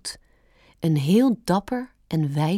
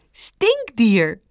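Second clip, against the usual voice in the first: higher, amused correction; name it with teasing glee; Stampertje giggling; 4 semitones higher than usual. A narrator's reading. Rabbit naming the animal for Bambi, giggling. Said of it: "giechelde Stampertje".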